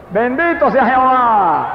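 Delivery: slow fervent praise. Real, not acted. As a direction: lift it up slowly in praise.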